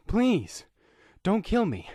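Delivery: high, loud, frantic pleading; begging for his life. Acted. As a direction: plead like a man at gunpoint.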